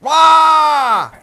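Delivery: put-on a sudden loud high baby's wail. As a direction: wail like a hungry baby. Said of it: "what does it do?".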